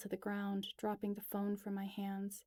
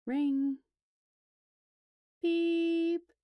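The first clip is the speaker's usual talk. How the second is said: plain reading voice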